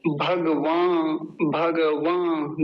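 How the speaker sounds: भगवान drawn out syllable by syllable, very slow; slow careful pronunciation. Shown as a demonstration. Teacher modelling a mantra word for students, slow and deliberate. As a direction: spell out भगवान slowly for learners. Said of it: "अगला तीसरा शब्द भगवान".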